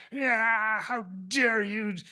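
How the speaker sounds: a hammed-up furious cat scolding the kitten; angry cat. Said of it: "the cat is pissed, really pissed".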